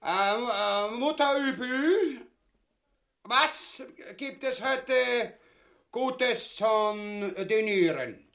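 high, slow, drawn-out enquiry; mock-genteel singsong question; affected politeness. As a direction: stretch each word in a high voice. Affected dinner question before guests.

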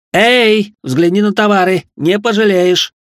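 loud, high hawker's call; loud street hawker. Acted. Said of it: "горланищую на всю улицу зычным голосом".

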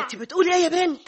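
a sharp, shocked question to the daughter; shocked demand. Acted. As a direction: recoil in disbelief. Mother shocked by daughter's news.

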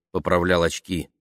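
ordinary delivery

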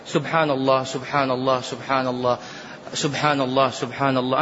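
unhurried tasbih. Shown as a demonstration. Showing how to glorify God unhurried, calm and measured. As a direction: say each "سبحان الله" calmly, no rush. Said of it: "أصبر يا شيخ، أصبر شوية".

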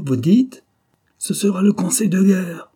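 high, loud indignant threat; outraged. A narrator's reading. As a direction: threaten court martial in fury. Commander shocked and threatening.

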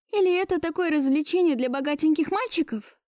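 high, mildly scornful question; accusing. A narrator's reading. Woman talking over her rich lover, accusing. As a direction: cut across him with a pointed question.

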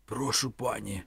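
a touch of hoarseness; hoarse. A narrator's reading. Man hands over the bathrobe, hoarse. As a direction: say it slightly husky. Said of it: "хрипко сказав він".

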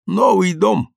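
mildly apologetic, pitched high. A narrator's reading. Guest excuses the wall's language, apologetically. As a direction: explain it as a slight apology. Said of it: "как бы извиняясь за выражение стены".

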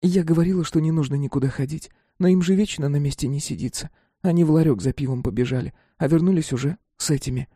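put-on high-pitched complaining young girl; girl's voice. A narrator's reading. Girl tells how the boys ran for beer, high-pitched complaint. Girl explains what happened.

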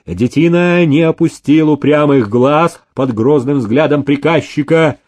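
plain reading voice

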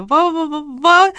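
high, teeth-chattering stammer; stuttering with fright, unable to form a word; terrified babble. A narrator's reading. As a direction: babble helplessly on one syllable. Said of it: "пролепетала девушка".

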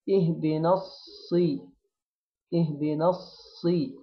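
اهدنا الصي drawn out slowly, the صي said with a clear kasra; kasra on sad. Shown as a demonstration. Teacher stressing the kasra of sad for students. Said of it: "أأكد على الكسرة بتاع الصاد".

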